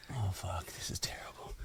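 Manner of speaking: barely voiced whisper to himself; very soft, breath-only retold reaction; whispered dismay. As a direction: whisper it under your breath as a private aside. His retold inner reaction, whispered.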